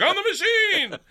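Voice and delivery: very high, loud laughing boast. Retold or imitated. Narrator boasting to the gangsters, laughing and high. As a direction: crow it proudly, laughing. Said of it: "And I'm like".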